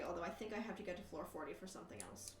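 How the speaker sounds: ordinary talk, nothing marked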